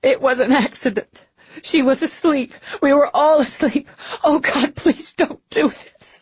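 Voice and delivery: high, tearful, desperate voice; anguished pleading. A narrator's reading. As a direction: plead through sobs, slowing at the end. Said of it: "Alika covered her face with her hands, rocked".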